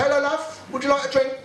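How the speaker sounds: high, cooing offer; light mock charm; sweet offer. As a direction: sweetly ask a woman colleague. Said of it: "You say".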